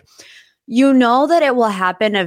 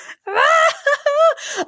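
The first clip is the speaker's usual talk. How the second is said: high, shrieking laugh through tears; overwhelmed happy laugh; tearful burst of laughter. Real, not acted. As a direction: release it in a big crying laugh. Bursting after wedding news.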